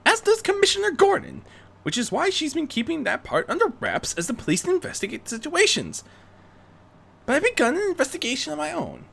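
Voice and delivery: Rarity briefing. Acted. Rarity briefing Bruce on the weapons, high and urgent. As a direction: lay out the facts urgently.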